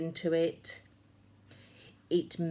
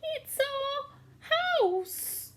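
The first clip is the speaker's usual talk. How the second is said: squeaky mouse. A narrator's reading. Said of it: "squeaked Mother Mouse".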